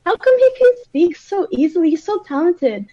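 retold wide-eyed wonder; naive amazement.

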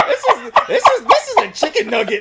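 high, loud roast through laughter; real laughing exasperation; laughing rant. Real, not acted. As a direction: crack up while insulting it. Roasting the creature while cracking up.

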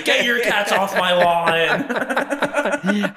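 mock menacing O J. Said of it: "wave a knife over my head and say".

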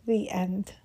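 whispered ending. Acted. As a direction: breathe it out as a whisper.

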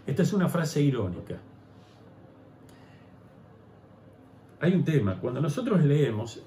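loud, high, driving sermon voice; full-force preaching. Acted. Pastor preaching loudly to the congregation about repentance.

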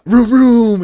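loud, high vroom noises; imitated engine revving. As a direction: rev up like a car engine.

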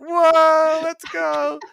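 high, loud delighted whoop; whooping at the trade news, high and loud; retold thrilled cheer. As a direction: cheer like a kid whose team just landed a star. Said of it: "And I was like".

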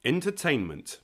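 ordinary delivery

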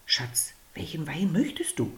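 husband whispers to his companion; soft whisper. Acted. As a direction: murmur the question to her. Husband asks softly.

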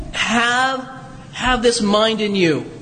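ordinary talk

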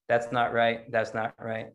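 low, curt, repeated verdicts; Brad Warner impression. Teacher walking round the new center, low blunt impression. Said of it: "This, this is my Brad Warner impression".

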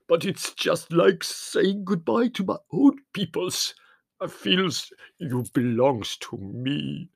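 sobbing Spiro. A narrator's reading. Spiro saying goodbye to the family, tearful. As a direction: choke through the farewell. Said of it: "he sobbed".

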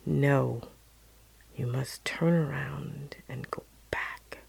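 soft, hushed, firm instruction; mysterious voice. Retold or imitated. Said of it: "the voice returned and said".

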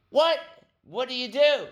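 very high British disbelief; baffled mock British agent; British accent. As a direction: squeal it in a put-on British accent. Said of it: "He was British".

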